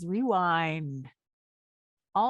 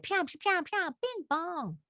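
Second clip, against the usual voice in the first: sound effect noises; 11 semitones higher than usual. Shown as a demonstration. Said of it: "that's what they would have sounded like".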